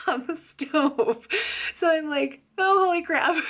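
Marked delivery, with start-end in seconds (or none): 2.6-3.4 high, loud panicked cry of shock; startled shout at a fire; alarmed yelp. Retold or imitated. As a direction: blurt it out in panic at the flames. Panic at seeing stove fire.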